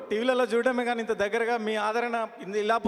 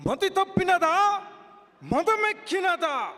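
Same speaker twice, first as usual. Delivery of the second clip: furious Yama dialogue. Acted. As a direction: roar it as the enraged god of death scorning mankind. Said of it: "ఆవేశంతో dialogue చెప్పిన". (ఆవేశంతో గర్జించే యముడి dialogue)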